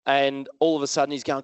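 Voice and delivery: ordinary talk, nothing marked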